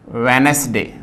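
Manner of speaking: वेडनेसडे as three syllables, ve-nas-de, with an s; common mispronunciation. Shown as a demonstration. Teacher quotes the common mistake, three-syllable वेडनेसडे. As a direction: say वेडनेसडे with a middle vowel and hissed s. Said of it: "बोलते हैं वेडनेसडे लेकिन गलत".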